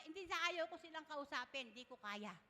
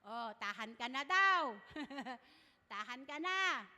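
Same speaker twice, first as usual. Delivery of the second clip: loud comforting call; 10 dB louder than usual. Retold or imitated. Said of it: "may pinatugtog siyang kanta".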